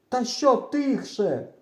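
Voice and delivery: high, slow indignant retort; indignant yell. A narrator's reading. Boy answering his hidden friend, indignant yell. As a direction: yell back at being hushed. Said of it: "кричу я".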